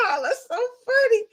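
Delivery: put-on high, fast, giggling girlfriend voice; a high, fast girlfriend voice, laughing as she talks; giggly friend. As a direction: gush it out like a tipsy friend laughing. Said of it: "here comes her friend saying".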